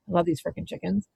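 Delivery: usual speaking voice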